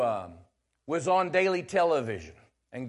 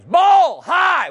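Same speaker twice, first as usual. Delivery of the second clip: loud high umpire shout; umpire's call; 16 dB louder than usual. Retold or imitated. Preacher imitating the umpire calling ball high. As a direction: bark it out like an umpire.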